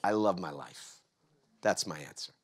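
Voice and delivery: low, hushed, intimate reveal; soft, low and heartfelt; soft sincerity. Real, not acted. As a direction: drop right down to a quiet confession. Reveals answer after loud build-up.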